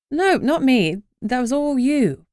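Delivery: emphatic denial, pushing back. A narrator's reading. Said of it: "I said emphatically".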